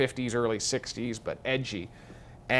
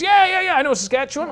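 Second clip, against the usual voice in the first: much higher, eager and chummy general voice; excited Texan general; 6 dB louder, faster than usual. Retold or imitated. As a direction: play the little Texan general, thrilled to meet them. Retold general from Texas talking.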